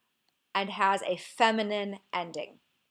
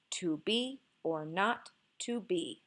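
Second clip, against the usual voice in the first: slow iambic "To be or not to be", weight on "be", "not", "be"; stress on be, not, be; 5 dB quieter, slower than usual. Shown as a demonstration. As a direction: scan it slowly, leaning on "be", "not" and "be". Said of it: "emphasis on the words be, not, and be".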